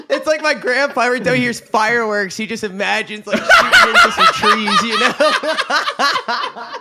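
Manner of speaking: high-pitched, breaking into laughter; laughing. Real, not acted. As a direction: laugh your way through it. Cracking up at his own joke.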